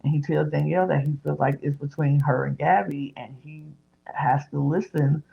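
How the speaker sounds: normal talking voice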